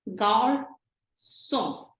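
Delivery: "garçon" slowly as "gar-sson"; S sound for ç. Shown as a demonstration. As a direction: split "garçon" in two, with a clear S. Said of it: "we have an S sound".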